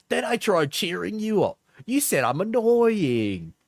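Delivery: high-pitched mock Cockney whine; attempted Cockney. Streamer reading an angry partner's message, mock Cockney. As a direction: put on a high, exaggerated Cockney. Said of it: "It's Cockney, I think".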